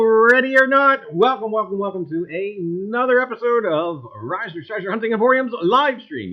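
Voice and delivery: loud, high, hyped-up host welcome; show host intro. Acted. Host welcoming his livestream gang with a loud, high hype. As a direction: boom it out like a show opener.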